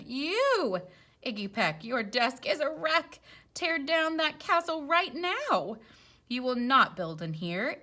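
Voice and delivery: loud, high, angry scolding; scolding teacher. Acted. Teacher scolding Iggy loudly about his castle. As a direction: bark it at the messy pupil.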